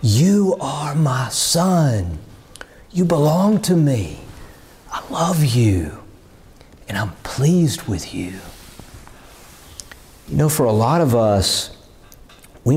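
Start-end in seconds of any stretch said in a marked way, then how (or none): 0.0-8.3 tender whispered blessing. Retold or imitated. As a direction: breathe it close, like a father to his child. Preacher voicing the Father's blessing again.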